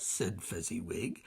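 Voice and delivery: plain reading voice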